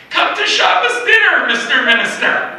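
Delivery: high, loud and bursting with excitement; eager invitation. Retold or imitated.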